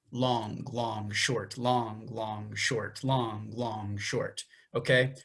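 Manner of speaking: "long, long, short" spoken as the rhythm pattern; tresillo rhythm count. Shown as a demonstration. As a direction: say "long" stretched and "short" quick. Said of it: "if I were to count this out".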